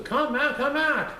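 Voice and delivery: high calling yell; old couple calling him back; calling after him. Acted. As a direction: shout after the runaway cookie. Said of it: "were yelling too".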